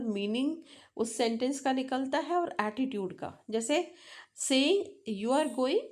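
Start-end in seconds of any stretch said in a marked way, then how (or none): 5.2-5.8 "You are going" with pitch rising on going; statement words, questioning rise on "going"; rising question intonation. Shown as a demonstration. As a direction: lift the end of "going" to turn the statement into a question. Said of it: "आपने इसको rising pitch पे बोला है".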